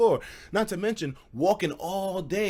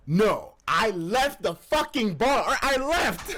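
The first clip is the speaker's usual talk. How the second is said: ordinary talk, nothing marked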